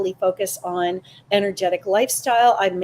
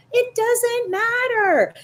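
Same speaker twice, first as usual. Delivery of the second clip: high drawn-out dismissal; 12 semitones higher, much slower than usual. Real, not acted. Emphatic dismissal.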